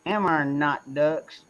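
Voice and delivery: slow, lightly voiced hillbilly drawl on stem ducks; hillbilly accent. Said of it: "they use single letters".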